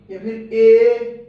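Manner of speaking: slow, loud, stretched ए; teacher stretching the vowel ए; drawn-out vowel sound. Shown as a demonstration. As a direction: hold the ए sound out long for the class. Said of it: "ई, ई, ए".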